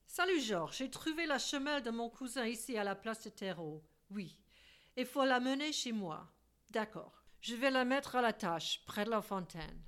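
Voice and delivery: lightly voiced Monsieur calling Georges in French; refined Frenchman on the phone.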